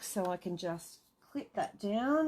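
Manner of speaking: ordinary talk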